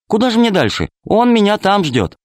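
put-on high girlish voice, eager; a little girl impatient to go; girl's voice. A narrator's reading. Alice answers. (голос девочки)

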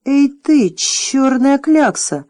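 loud, grunting dragoness, slowing on the insult. A narrator's reading. Dragoness grunting at Jim, loud and slow. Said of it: "прохрюкала драконица".

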